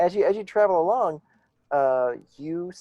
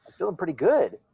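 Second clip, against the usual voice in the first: confident; 5 semitones higher, faster than usual. Acted. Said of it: "you suddenly start to feel really confident".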